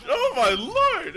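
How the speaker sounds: drawn-out astonished shout; shocked yell. Real, not acted. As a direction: belt it out in disbelief. Stunned by a teammate's play.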